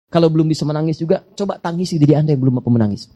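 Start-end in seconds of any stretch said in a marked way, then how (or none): none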